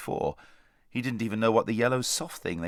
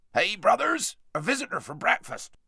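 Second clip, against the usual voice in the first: calling out; 11 semitones higher than usual. Acted. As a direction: call to the brothers inside. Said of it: "Duffel called out".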